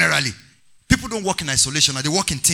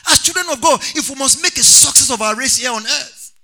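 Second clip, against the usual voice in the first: loud, high-pitched sermon delivery; rousing pulpit cry to the congregation; full-force preaching; 7 semitones higher, 5 dB louder than usual. Real, not acted. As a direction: preach it loud, stretching the key words.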